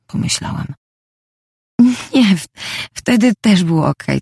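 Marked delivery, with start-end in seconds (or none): 2.1-4.2 higher, stumbling denial; flustered stammer. A narrator's reading. Heroine backtracks after a slip to the man, flustered stammer. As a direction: trip on the first word, then correct yourself.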